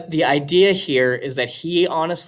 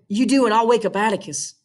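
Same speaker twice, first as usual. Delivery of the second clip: higher little sister making a threat; higher girl's voice warning him off; 8 semitones higher than usual. Acted. Scout threatens, Jim answers.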